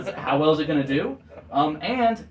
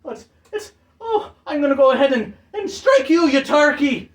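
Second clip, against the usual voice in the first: put-on high, loud cracking old man yell; an outraged old bowler striking back; angry old man; 11 semitones higher, 8 dB louder than usual. Old bowling captain yelling at the leprechaun, high and loud.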